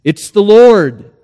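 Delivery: slow, loud, stately answer; slow, booming reply; voice of the Lord. Acted. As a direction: announce yourself grandly from above. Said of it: "The voice answer-, answered".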